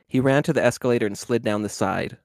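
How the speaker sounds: plain reading voice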